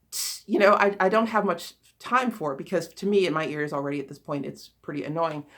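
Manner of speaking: normal talking voice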